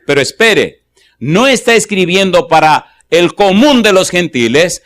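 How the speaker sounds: predica con énfasis, marcando las frases; enseña el versículo con peso y pausas; predicación expositiva. Acted. Expone el texto bíblico.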